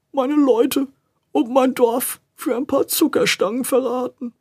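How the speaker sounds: high, slow, ashamed stammer. A narrator's reading. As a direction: stammer in shame. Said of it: "und stammelte".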